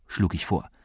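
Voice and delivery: ordinary delivery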